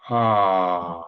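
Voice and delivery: toned ah. Acted. Breathwork leader toning a long ah with the group. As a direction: sound the ah from the base up to the crown. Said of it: "the same sound".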